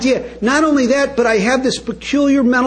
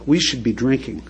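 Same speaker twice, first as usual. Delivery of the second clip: low, soft inner voice of craving; the mind's voice, low and soft; voice of obsession; 8 semitones lower, 4 dB quieter than usual. Retold or imitated. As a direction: whisper it like the addiction tempting you. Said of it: "the way it goes is".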